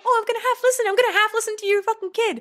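high whiny mock of Tyler. Wife mocking the hired guy's words, high and whiny. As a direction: sneer it back in a high silly voice. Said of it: "he's saying".

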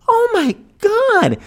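hammed-up sobbing oh my God; tearful shock. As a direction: wail it out in sympathy. Shocked sympathy, crying.

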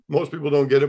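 usual speaking voice

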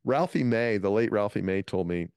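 ordinary talk, nothing marked

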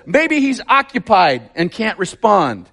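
prissy version of the jeer; mild mocking. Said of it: "In the N I V version, it says".

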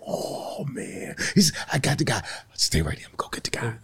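whispered conspiratorially; mock whisper. Comedian whispering to the new guy, hushed.

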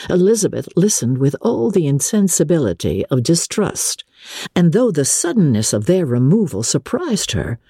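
usual speaking voice

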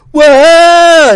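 very high, very loud startled whoa; mock startled shriek; startled scream. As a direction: jump back in fright at hearing her name. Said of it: "she runs away, like, screaming".

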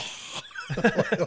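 long voiceless exhale; imitated machine giving out with a sigh; computer powering down. Computer shutting down after finishing the file, spent exhale. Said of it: "you just hear the computer go".

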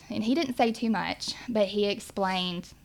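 usual speaking voice

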